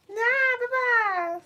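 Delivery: high laugh into a playful bye; giggling goodbye, high. Real, not acted. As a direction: laugh and brush it off. Laughing at own singing.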